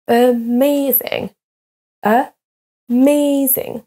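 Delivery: "Amazing" slowly as a-MAY-zing; second-syllable stress. Shown as a demonstration. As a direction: split "Amazing", stretching MAY. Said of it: "accentués sur la deuxième syllabe".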